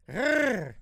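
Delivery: long rolled-R trill; cat purr. Shown as a demonstration. Host showing off his purr, trilled cat noise. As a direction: imitate a kitty cat purring with the tongue. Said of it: "Like a kitty cat".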